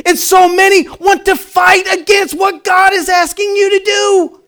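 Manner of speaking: loud, high preaching cry; preaching shout. Real, not acted. Pastor shouting to the church about fighting God. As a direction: drive it home to the congregation. Preacher driving his point home.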